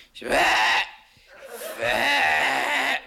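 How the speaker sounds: high, loud horrified shriek from the aunt; a yelped aunt's scream of horror; shocked shriek. Retold or imitated. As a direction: shriek like she's just seen the tattoo. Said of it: "she looks and she flips".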